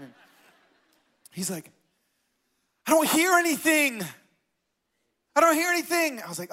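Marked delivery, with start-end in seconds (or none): 2.9-6.2 imitating a high-pitched protest; frustrated complaint. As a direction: act out an exasperated friend, pitched well up. Acting friend's frustrated complaint.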